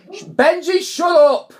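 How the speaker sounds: sharp loud yell at the dog; shouting at dog. Real, not acted. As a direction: bark it out to silence the dog. Yelling at barking dog.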